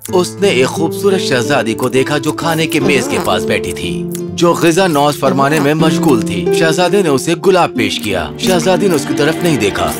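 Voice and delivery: usual speaking voice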